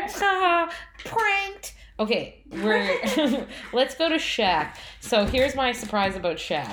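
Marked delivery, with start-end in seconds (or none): 0.0-1.7 gloating taunt. Pretend. Gloating at her brother.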